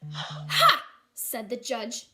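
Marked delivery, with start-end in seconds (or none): 0.0-0.9 loud high mocking ha; scoffing ha. Judge scoffing at Matthew, loud. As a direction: bark a dismissive laugh.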